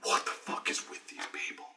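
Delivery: whispered disbelief; aghast whisper. Retold or imitated. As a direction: whisper it as a stunned aside. Game master's aghast aside.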